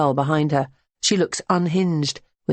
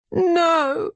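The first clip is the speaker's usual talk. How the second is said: wail; 14 semitones higher than usual. A narrator's reading. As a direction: wail out in grief. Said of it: "Berenice wails".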